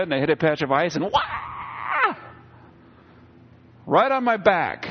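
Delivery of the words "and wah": high yelp of falling on ice; startled yelp. Retold or imitated. Retelling his fall on ice, a high startled yelp. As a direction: let out a sudden slipping cry. Slipping on ice while delivering.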